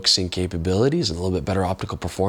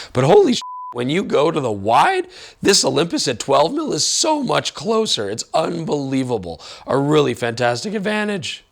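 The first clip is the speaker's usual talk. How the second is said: excited; 8 semitones higher than usual. Real, not acted. Excited by close focus.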